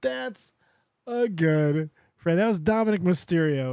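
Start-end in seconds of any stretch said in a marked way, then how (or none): none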